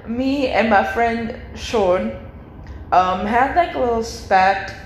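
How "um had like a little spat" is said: ordinary talk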